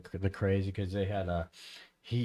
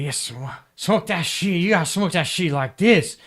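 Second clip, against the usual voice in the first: imitating his talk; 8 semitones higher, 6 dB louder than usual. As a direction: play him trying to fit in. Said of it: "then what he would say".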